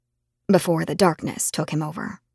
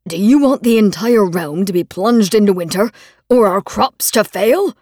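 louder stern father yelling; 7 dB louder than usual. Acted. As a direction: yell back like an angry father. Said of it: "my father yelled back".

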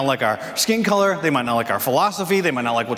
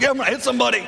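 pumped up; 9 semitones higher, faster than usual. Retold or imitated. Grabbing kids on the couch.

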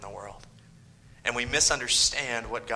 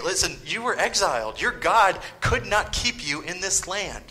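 higher, scornful jeer at the exiles; mocking taunt; 7 semitones higher, 4 dB louder than usual. Enemies taunting exiled Israel, scornful. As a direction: sneer it at them. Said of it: "they're saying".

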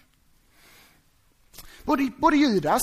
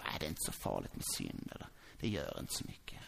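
much lower, soft, offhand voice; dismissive shrug; 14 dB quieter than usual. Acted. Preacher mocking those who shrug off sin, low and dismissive. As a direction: brush it off like it's nothing.

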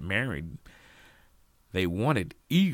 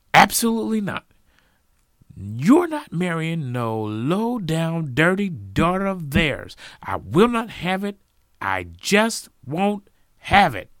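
loud, high, emphatic refusal; outraged father; 10 semitones higher, 9 dB louder than usual. A narrator's reading. Father forbids Jacob to marry Sally, furious. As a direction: thunder it, stretching the words for force.

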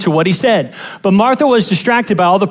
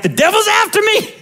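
much higher, whiny mock alarm; mock panic; 12 semitones higher than usual. Churchgoer blaming the devil for the power bill, high mock panic. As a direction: squeal it like an overdramatic believer. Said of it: "we're like".

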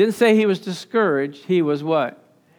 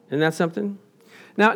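normal talking voice

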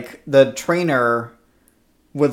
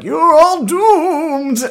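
high, drawn-out prophet-of-doom wail; doomsaying deckhand; 13 semitones higher, 6 dB louder, much slower than usual. Retold or imitated. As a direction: wail the warning like a crazed sailor. Boat hand's doomsaying line.

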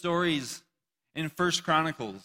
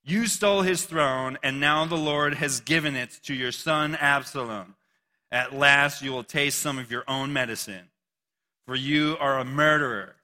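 scripture reading; 5 dB louder than usual. Acted. Shimei's curse read on.

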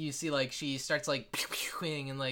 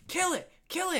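put-on very high, excited child's chant; egging-on kid. First kid urging the player to attack, high squeal. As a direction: squeal it to urge your friend on. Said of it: "the first kid's like".

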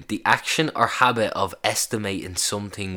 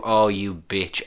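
normal talking voice

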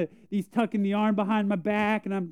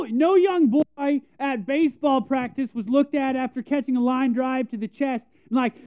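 ordinary delivery

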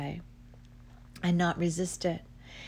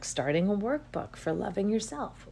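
usual speaking voice

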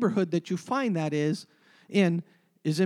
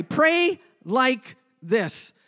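slow emphasis; 5 semitones higher, 5 dB louder, much slower than usual. Shown as a demonstration. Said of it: "I think you need to write this down".

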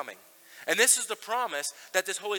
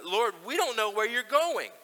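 rising, louder puzzled question; Thomas doubting; 6 semitones higher, 6 dB louder than usual. Retold or imitated. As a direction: ask it like a confused disciple, pitch climbing. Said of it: "he says".

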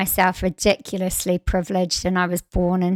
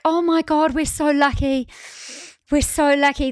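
higher, gushing with gratitude; grateful gushing; 6 semitones higher than usual. Retold or imitated. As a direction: gush how lucky you feel. Daily family refrain.

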